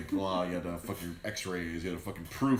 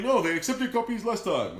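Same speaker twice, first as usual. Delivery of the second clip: a hint of an indignant foreign customer, higher and louder; 12 semitones higher, 7 dB louder than usual. Customer insisting to the clerk, mock indignant and higher. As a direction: protest like you were wronged. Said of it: "trying not to do the accent".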